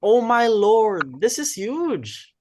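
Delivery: high, loud, slow thrilled exclaim; excited gasp. Acted. To the club hearing Marife has a boyfriend, thrilled high exclaim. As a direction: savor the juicy news.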